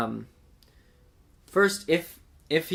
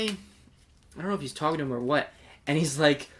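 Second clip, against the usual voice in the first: ordinary talk, nothing marked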